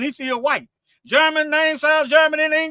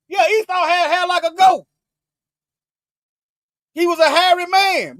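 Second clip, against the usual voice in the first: preaching shout; 6 semitones higher, 3 dB louder than usual. Real, not acted. Preacher repeating point in shout.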